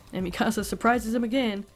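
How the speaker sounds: ordinary delivery, nothing marked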